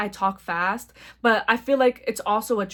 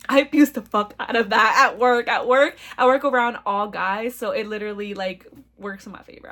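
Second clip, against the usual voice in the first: usual speaking voice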